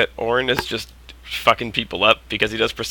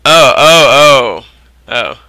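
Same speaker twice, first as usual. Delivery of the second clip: surprised; 3 semitones higher, 11 dB louder, much slower than usual. Real, not acted. Sudden surprise at player joining.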